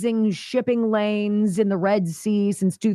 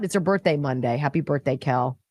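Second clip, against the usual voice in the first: usual speaking voice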